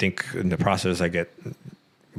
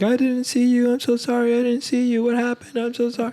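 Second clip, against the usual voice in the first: high, tearful apology, voice pitched up high; crying woman. Retold or imitated. Driver who crashed into them, high tearful apology. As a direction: imitate a sobbing driver begging forgiveness. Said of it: "She's crying, screaming".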